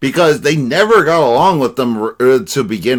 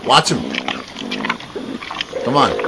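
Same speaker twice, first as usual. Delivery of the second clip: ordinary talk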